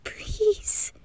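a shy, breathy whispered brush-off; 수줍게 속삭이며. Acted. Embarrassed reply to compliment.

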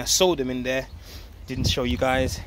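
normal talking voice

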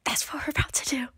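unvoiced secret whisper. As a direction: lean in and whisper it. Whispering the next workout.

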